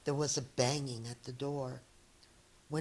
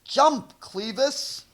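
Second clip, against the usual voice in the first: higher, much louder urging; shouted; 8 semitones higher, 9 dB louder, slower than usual. Acted. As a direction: call it out to the frightened cat. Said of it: "Dex shouted".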